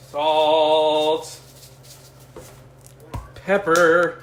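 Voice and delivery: each word stretched and held, loud; loud drawn-out call. Pretend. Host announcing seasonings while cooking, loud drawn-out call. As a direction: belt each seasoning as one long word.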